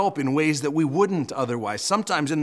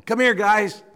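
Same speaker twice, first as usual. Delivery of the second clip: raised, higher mock call; yelling in; 7 semitones higher, 5 dB louder than usual. Amazed king summoning men from the furnace, playful yell. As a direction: call them over from a distance. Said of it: "And he yells in there".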